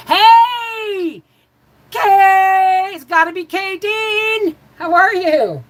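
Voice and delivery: excited shout-out. Real, not acted. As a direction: call out to a friend spotted in the chat. Greets a viewer in live chat.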